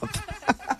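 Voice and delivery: bursting into high laughter; high breathy laugh. Real, not acted. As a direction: crack up at the reveal. Prank host laughing.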